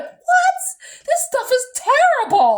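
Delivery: very high, loud mock outrage; appalled mom. Mom reacting to the taste of Sunny D, mock appalled. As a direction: screech it like an appalled mom, hammed up.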